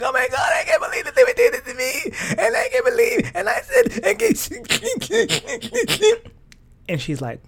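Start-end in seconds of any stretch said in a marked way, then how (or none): 0.0-6.3 high, loud mock sobbing; hammed-up wailing, words squeaked out fast through tears; hysterical crying. Daughter phoning mom after being let go, hysterical crying. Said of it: "hysterical on the phone".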